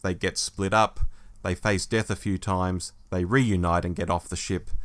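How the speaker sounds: normal talking voice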